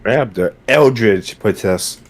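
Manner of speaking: ordinary delivery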